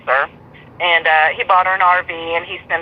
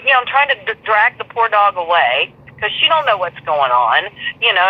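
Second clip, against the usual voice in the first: normal talking voice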